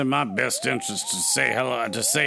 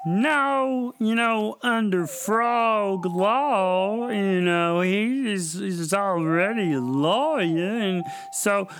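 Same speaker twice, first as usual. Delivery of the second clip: higher nasal-free cartoon frog voice; 7 semitones higher than usual. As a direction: play a fast-talking frog cousin. Frog cousin character voice.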